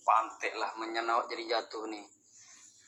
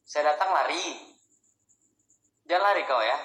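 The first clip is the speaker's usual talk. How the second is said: threatening call; 5 semitones higher, 5 dB louder than usual. Acted. Threatening the spirit.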